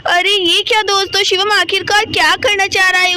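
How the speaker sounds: plain reading voice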